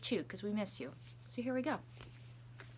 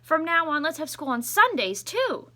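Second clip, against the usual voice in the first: excited principal; 7 semitones higher, 7 dB louder than usual. Acted. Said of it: "Mister Keene announced".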